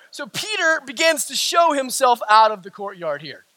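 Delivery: ordinary delivery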